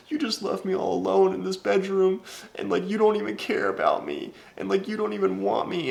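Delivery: very high, monotone mock whimper; imitating crying girlfriend. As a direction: mimic a tearful ex in a squeaky-high voice. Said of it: "she started crying in my bed".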